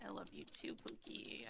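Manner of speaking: soft whisper to the cat; very soft whispered "I love you too". Real, not acted. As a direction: breathe it tenderly. Tender words to cat.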